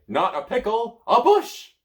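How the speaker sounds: high, loud, delighted; gleeful. Acted. Merlin gleefully correcting the child, high and loud. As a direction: crow with glee. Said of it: "says gleefully".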